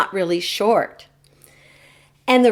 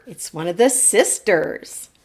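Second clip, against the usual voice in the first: usual speaking voice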